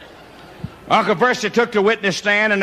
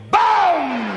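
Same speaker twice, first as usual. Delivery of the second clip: sharp high "Boom!" for a pistol shot; imitated gunshot; 12 semitones higher than usual. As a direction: bark out a gunshot sound.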